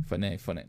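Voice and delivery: "Phone, phone" said softly, each as fuh-NAY; Greek pronunciation. Shown as a demonstration. Preacher practising the Greek word, "Phone, phone" each pronounced fuh-NAY. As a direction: repeat "Phone, phone" quietly with a sounded "ay" ending. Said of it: "is pronounced phone".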